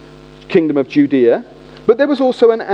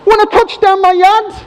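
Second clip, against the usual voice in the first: much higher, loud hammed-up slang; fake cool guy; 12 semitones higher, 4 dB louder than usual. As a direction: overeager try-hard youth talk. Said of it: "and then I say".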